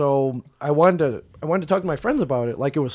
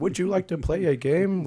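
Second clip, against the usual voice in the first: lightly voiced movie-game line, pitched higher; a hint of a film impression, raised pitch; WarGames quote; 5 semitones higher than usual. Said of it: "like freaking Matthew Broderick or War Games".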